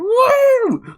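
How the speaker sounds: high, loud imitated "woo"; crowd member's whoop. Co-host imitating a sarcastic guy in the crowd, high whooping cheer. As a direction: whoop like an over-keen audience member. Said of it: "in the crowd and went".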